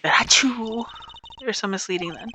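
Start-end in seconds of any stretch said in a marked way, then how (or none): 0.3-0.8 fake sneeze. Sneeze in the game.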